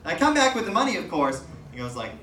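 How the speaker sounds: usual speaking voice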